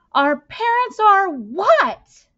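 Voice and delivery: slow, very high and loud disbelieving shout. Acted. Boy shouting at the fussing adults, slow and very high. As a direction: stretch each word out in a shocked yell. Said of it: "then shouted".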